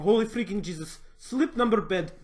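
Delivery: hammed-up Russian sales pitch; mock ad voice. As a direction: hype the bed like an over-the-top ad. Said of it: "I was going".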